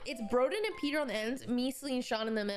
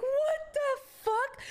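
high, drawn-out retold disbelief; incredulous; 10 semitones higher, slower than usual. Riders thrown around on the water, incredulous drawn-out exclamation. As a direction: draw each word out slowly and high. Retold group bewilderment on the ride.

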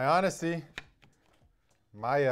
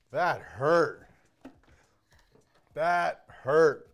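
pained emphasis; 8 dB louder, much slower than usual. Real, not acted. Still reacting to hit finger.

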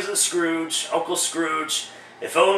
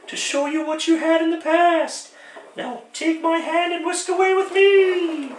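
much higher, airy woman's voice for the ghost; falsetto female ghost; 11 semitones higher than usual. Acted. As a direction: play the spirit as a tall lady. Ghost speaks again.

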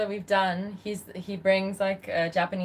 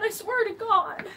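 high protest; 14 semitones higher than usual. Real, not acted. Protesting that the sword is fake.

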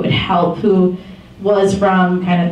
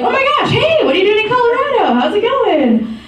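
much higher, laughing, quick burst of surprised delight; excited greeting; 13 semitones higher than usual. Retold or imitated. Katie greets her donor in Colorado, laughing and excited. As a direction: gush like you just met a fan.

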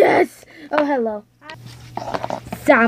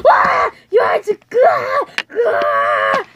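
high, crying screams; terrified screaming; 12 semitones higher, much slower than usual. Acted. Character sees the creepy eyes, high terrified screams.

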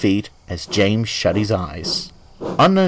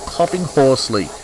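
ordinary delivery

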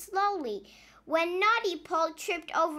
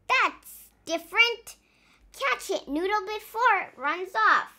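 character voice; 7 semitones higher, 4 dB louder than usual. Acted. Character dialogue.

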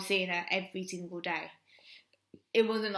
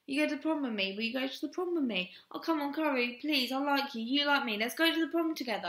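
higher-pitched mock begging; joking pleading; 6 semitones higher than usual. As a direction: imitate a boy jokingly pestering for a date. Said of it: "he joked around, kept going".

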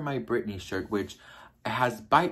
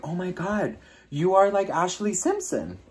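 excited blurt; 8 semitones higher than usual. Retold or imitated. Retells her own blurted remark.

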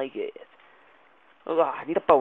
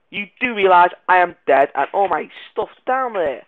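ordinary delivery

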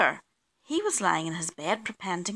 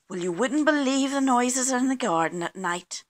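usual speaking voice

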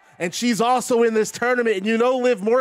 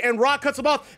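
ordinary talk, nothing marked

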